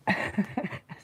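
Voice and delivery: real burst of chuckling; amused laugh. Real, not acted. Storyteller revealing it was a cow, amused laugh. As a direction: crack up at your own punchline.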